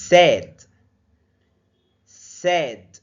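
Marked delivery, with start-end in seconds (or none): none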